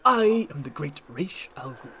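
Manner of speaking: a slow, measured impression; deliberate and drawn out, imitating the actor; Liam Neeson. Streamer showing off an impression, slow Liam Neeson take. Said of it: "I can do a decent Liam Neeson".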